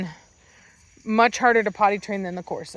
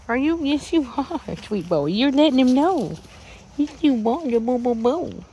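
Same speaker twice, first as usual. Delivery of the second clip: higher sing-song cooing; 3 semitones higher than usual. Real, not acted. Owner cooing praise at her puppy, higher baby talk.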